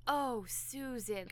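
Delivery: slow, soft mock dismay; dramatic dismay. Mom reacts to daughter in retelling, dramatic dismay. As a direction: let it sink out slowly and softly. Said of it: "just kinda sits back dramatically".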